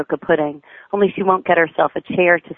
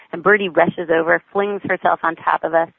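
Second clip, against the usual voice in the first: usual speaking voice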